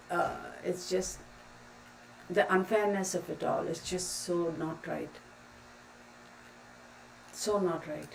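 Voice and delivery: barely getting the words out through emotion; quiet, emotional trailing off; holding back tears. Real, not acted. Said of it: "getting emotional now".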